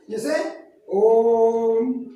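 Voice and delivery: ओम with the ओ held very long; prolonged pluta vowel. Shown as a demonstration. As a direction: chant ओम slowly, stretching the vowel. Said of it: "इस तरीके से हम इसको लंबे स्वर में बोलते हैं". (ओम को लंबा खींचकर बोलें)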